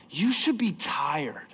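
slow hushed whisper. Real, not acted. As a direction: breathe it out barely voiced. Repeated line, whispered then called.

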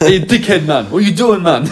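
loud, high British accent edging on Jamaican; jokey British accent. Shown as a demonstration. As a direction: ham up British slang with a Jamaican lilt. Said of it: "our jokey one rides on the edge of Jamaican".

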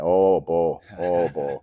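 imitated North Dakota drawn-out vowels; light impression of a North Dakota accent. Coach imitating colleague on the phone, North Dakota accent. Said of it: "a North Dakota accent".

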